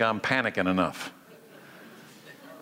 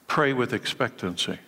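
ordinary delivery

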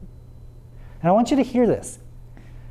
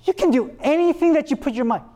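mock flattery; 8 semitones higher than usual. Preacher mocking a flattering God, gushing praise. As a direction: gush over them like a flatterer. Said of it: "God doesn't do that".

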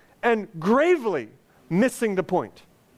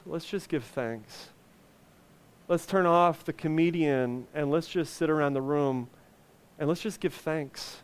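soft appeal; 6 semitones lower, 5 dB quieter than usual. Real, not acted.

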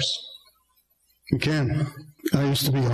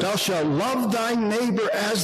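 scripture reading; 5 semitones higher than usual. Acted. Reads scripture aloud.

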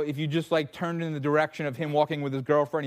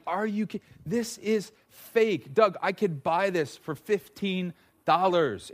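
higher, slow, disbelieving wife; incredulous wife; 4 semitones higher, slower than usual. Acted. Wife scoffing at the husband's fake doll, slow and incredulous. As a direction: drag it out in disbelief. Wife's incredulous retort, acted.

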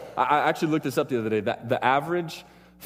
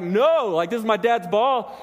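imitated panicked kid, pitched up; mock-frantic protest, voice raised high; freaking out; 8 semitones higher, 4 dB louder, slower than usual. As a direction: act out a kid mildly panicking. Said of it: "he's freaking out".

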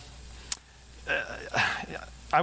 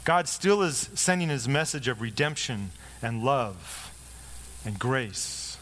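slow, solemn preaching cadence; slower than usual. Acted. Pastor to his congregation, slow solemn sermon close. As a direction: draw out the close of the sermon, slowly.

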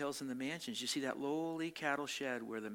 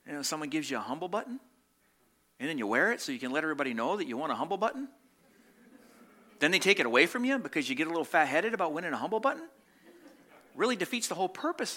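playful mockery; 6 semitones higher, 8 dB louder, faster than usual. Mocking humble-button pride.

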